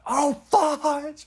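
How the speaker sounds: loud, high-pitched awed exclamation; shocked outburst. Retold or imitated. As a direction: re-enact being blown away, loud and high. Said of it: "I was like".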